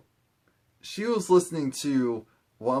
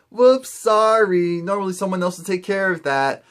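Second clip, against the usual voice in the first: high singsong sorry, tossed off; breezy mock apology; 5 semitones higher, 3 dB louder than usual. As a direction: imitate a careless apology in a higher voice. Imitating Yelena's careless apology.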